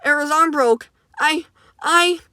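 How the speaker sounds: high, choked exclaim; Cross breaking down, mildly tearful; breaking down crying. Acted. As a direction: voice cracking into tears. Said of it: "Cross exclaims as he breaks down crying".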